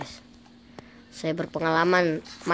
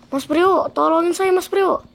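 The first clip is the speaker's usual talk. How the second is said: pleading; 11 semitones higher, 7 dB louder than usual. Pretend. Begging friend for help.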